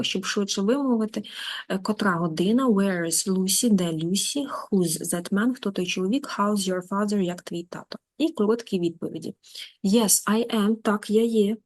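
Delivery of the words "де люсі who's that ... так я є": ordinary talk, nothing marked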